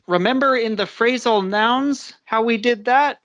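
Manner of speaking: ordinary talk, nothing marked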